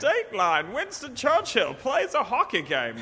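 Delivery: slow, high mock newsreel read; old newsreel announcer. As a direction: lightly play a 1940s news announcer. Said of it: "cut to [name] with the news".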